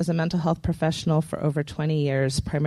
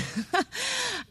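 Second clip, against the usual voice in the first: light laugh; 8 dB quieter than usual. Real, not acted. Laugh after joking remark.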